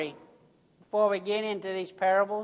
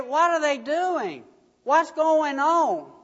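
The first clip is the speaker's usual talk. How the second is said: alarmed boss; 7 semitones higher, 4 dB louder than usual. A narrator's reading. Worried boss on phone.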